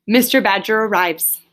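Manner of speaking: usual speaking voice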